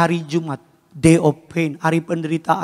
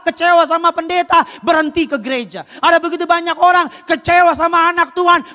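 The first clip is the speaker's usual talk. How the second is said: high, loud, driving sermon voice; full-force preaching; 12 semitones higher, 4 dB louder than usual. Real, not acted. Preacher to a church about people who quit on God, impassioned. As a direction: preach it hard, pitched high.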